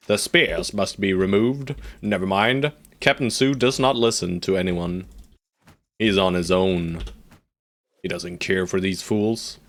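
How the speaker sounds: normal talking voice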